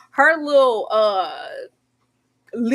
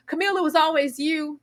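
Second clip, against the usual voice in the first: mock proposal line; 6 semitones higher than usual. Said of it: "drop down like".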